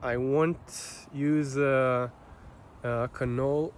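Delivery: normal talking voice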